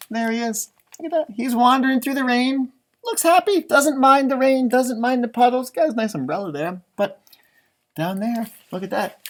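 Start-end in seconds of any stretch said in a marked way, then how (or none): none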